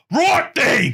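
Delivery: high-pitched shouted Brummie entrance; shouted Brummie impression. Imitating Arthur from Peaky Blinders entering, Brummie shout. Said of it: "anything that, that isn't shouting".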